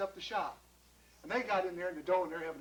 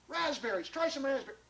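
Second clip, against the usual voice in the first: higher, excited offer; eager pitch; 6 semitones higher, 3 dB louder than usual. Acted. John offering berries to Mister Bischoff, eager. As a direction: push the goods enthusiastically.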